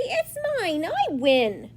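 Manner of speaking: high squabbling cry falling back; shouted rival claim. Acted. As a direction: insist on winning, like a squabbling sibling. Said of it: "shouted Hickory".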